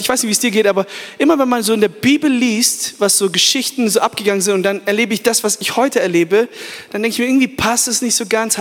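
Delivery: usual speaking voice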